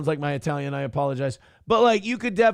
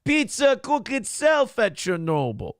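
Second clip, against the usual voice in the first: mock Russian accent; 6 semitones higher than usual. Continuing Soviet Russia joke.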